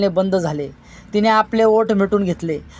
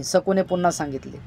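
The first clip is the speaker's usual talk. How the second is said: plain reading voice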